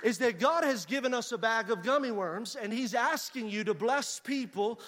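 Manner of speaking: preaching that builds to a high, loud climax; building preaching. Real, not acted. Preacher voicing God's promise to congregation, building preaching. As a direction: swell from firm exhortation to full-force proclamation.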